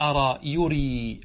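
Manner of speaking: slow أرى يري with long vowels; no hamza. Shown as a demonstration. Teacher points out the missing hamza, slow smooth forms. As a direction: glide through يري without a glottal catch. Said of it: "انظر، لا توجد همزة".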